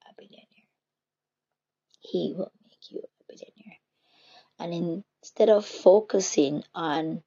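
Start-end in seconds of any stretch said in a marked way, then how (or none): 0.1-3.8 voiceless whisper on God's word; whispered promise. Real, not acted. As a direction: drop to a bare whisper. Quoting God's promise hushed.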